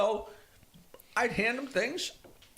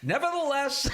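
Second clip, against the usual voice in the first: higher, deadpan announcer's comeback, laughing; 7 semitones higher, 3 dB louder than usual. Retold or imitated. Comedian delivering the punchline as the unbothered announcer. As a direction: brush it off in a mock formal tone. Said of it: "the announcer goes".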